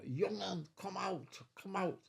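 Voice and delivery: high-pitched calling out, no real volume; imitated shout. Crewmate calling him out of his cabin, imitated shout. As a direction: call it out high, mimicking a crewmate. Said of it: "I heard one of the lads shout".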